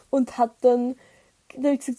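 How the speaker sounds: ordinary talk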